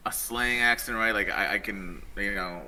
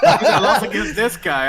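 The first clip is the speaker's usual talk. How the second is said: laughing quote; 12 semitones higher, 8 dB louder, slower than usual. Retold or imitated. Retelling opponent's words while laughing.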